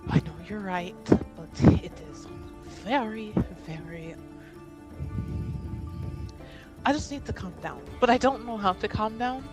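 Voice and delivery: agitated fretting. Acted. In character, agitated and stressed.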